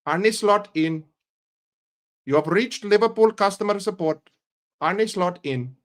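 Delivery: put-on Indian call-centre accent. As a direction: play customer support with an Indian accent. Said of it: "you'll think I'm fucking Indian".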